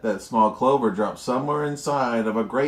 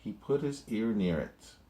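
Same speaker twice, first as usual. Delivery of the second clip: plain reading voice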